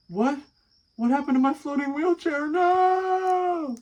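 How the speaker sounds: very high, loud sing-song wail; a cartoon villain crying out over his lost wheelchair; Smarticus wailing. Smarticus lamenting his floating wheelchair, high loud wail. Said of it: "and he's going".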